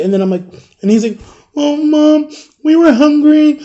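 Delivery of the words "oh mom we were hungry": high, whiny boy's mock cry. Crying friend confesses to his mom, imitated tearful whine. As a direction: imitate a kid wailing to mom. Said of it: "he's, like, crying".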